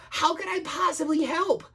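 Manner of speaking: high, frightened little dragon; timid and anxious; quaking with fear. Acted. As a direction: fret helplessly, high-voiced. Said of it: "who was quaking with fear".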